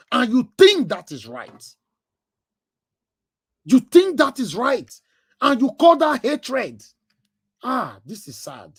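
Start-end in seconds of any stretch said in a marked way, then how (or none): 3.6-4.9 indignant. Real, not acted. Repeating the accusation louder. 5.4-6.8 indignant. Real, not acted. Indignant rhetorical question.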